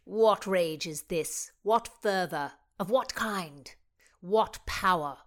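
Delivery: loud, impassioned reading of verse; dramatic poem recital. Acted. Oxford answering Rutland's poem, fiery recital. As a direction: hurl each line like a challenge.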